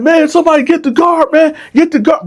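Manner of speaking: very high, fast frantic plea; desperate plea. Retold or imitated. Dylan begging other inmates to get the guard, frantic. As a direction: beg someone to fetch the guard. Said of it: "So Dylan like".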